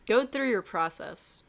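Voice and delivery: ordinary delivery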